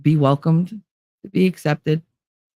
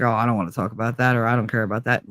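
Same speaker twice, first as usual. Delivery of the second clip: dismissive brush-off; 5 semitones lower, 5 dB quieter, faster than usual. Pretend. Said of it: "I'm not gonna be like".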